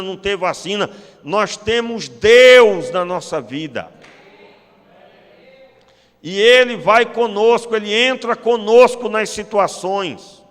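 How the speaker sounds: loud, slow, emphatic sermon; preacher driving home gratitude, slow and loud; full-force preaching. Acted. Pastor to the congregation, preaching loud and slow.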